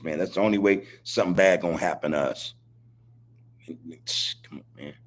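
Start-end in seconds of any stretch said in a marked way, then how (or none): 3.7-4.9 breathy unvoiced whisper. Real, not acted. Host whispering an aside to his chat. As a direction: hush it to yourself. Aside after serious point.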